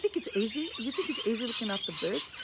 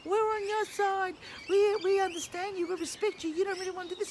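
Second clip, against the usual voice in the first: mocking the protester; 11 semitones higher than usual. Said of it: "the police are standing there saying".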